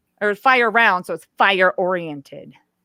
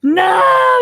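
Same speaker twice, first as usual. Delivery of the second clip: put-on high, loud child's no; imitating the upset son's yell; retold shout; 7 dB louder than usual. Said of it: "He plugs his ears".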